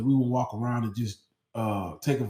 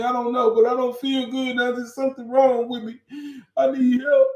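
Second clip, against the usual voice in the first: much higher and louder, near sobbing; mock crying plea; 13 semitones higher, 7 dB louder than usual. Student crying to the nurse for help, much higher and louder. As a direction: wail it like a scared kid.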